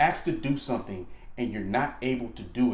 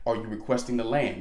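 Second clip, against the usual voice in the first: ordinary talk, nothing marked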